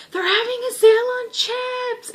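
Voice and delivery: high-pitched, hammed-up cry; mock excited announcement. Fan acting the guy interrupting the riverside moment, high mock-excited cry. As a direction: blurt it out in a high, thrilled squeal. Guy's announcement that spoils the mood.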